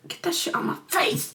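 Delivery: high, hushed mock refusal. Wife refusing pills from her husband, mock refusal. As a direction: squeal it softly through a half-voice. Acted swat at pills being forced.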